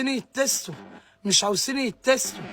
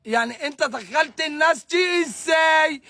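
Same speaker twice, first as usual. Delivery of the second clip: angry shout; 4 semitones higher than usual. Real, not acted. Angry rant at hall owner.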